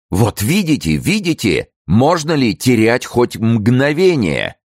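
high, agitated exclamation, slowing at the end; urgent insistence. A narrator's reading. Friend pressing the superintendent to flee, urgently. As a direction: insist there is no time left. Urging immediate flight.

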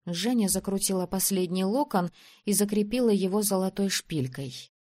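ordinary delivery

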